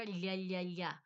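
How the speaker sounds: wide-tongued ya with a ly glide; Spanish ll drill. Shown as a demonstration. Demonstrating tongue position, ya with a wide ly glide. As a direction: repeat ya as a liquid 'lya'. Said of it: "the tongue gets a lot wider".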